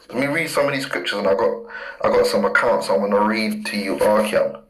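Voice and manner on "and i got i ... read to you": ordinary talk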